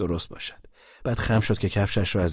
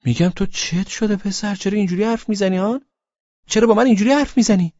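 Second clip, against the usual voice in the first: put-on pitched up, louder indignant girl; high hurt girl voice; 13 semitones higher, 6 dB louder than usual. A narrator's reading. Laleh demanding why he speaks like this, high voice. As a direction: demand it as the offended girlfriend.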